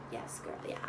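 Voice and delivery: breathy sassy whisper; hushed gossip aside. Real, not acted. Sassy aside while spilling tea.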